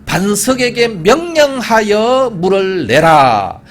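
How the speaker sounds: 반석에게 명령하여 물을 내라 drawn out slowly and loud; slow emphatic command. Acted. Preacher stressing God's instruction to Moses, slow and loud. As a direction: spell out God's command word by word. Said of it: "slow stressed quote of command".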